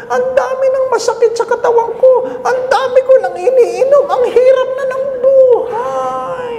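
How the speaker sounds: put-on very high, whining elderly complaint with laughs; high, laughing old woman's lament; whiny old lady. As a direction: ham up a grandmother listing her aches.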